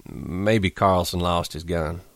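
soft, slow and weak; weakly. A narrator's reading. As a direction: barely get it out. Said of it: "George said weakly".

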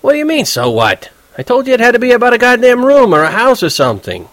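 high, loud, furious protest; angry roommate. Acted. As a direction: throw his words back at him, outraged. Roommate arguing angrily.

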